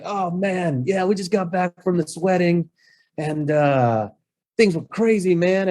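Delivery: high, easygoing put-on voice of a tired partier; partied-out worker. Locksmith explaining to the host he just left a wedding, loose. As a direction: sound loose and fresh from a wild wedding.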